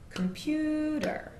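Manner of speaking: COMputer with long drawn vowels, high and slow; syllable length stretched. Shown as a demonstration. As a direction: stretch each syllable long and slow like pulling a rubber band. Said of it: "we go to the side".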